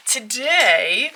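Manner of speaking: light game show host opener. Pretend. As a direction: announce it like a TV quiz host. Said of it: "I just really sounded like a game show host".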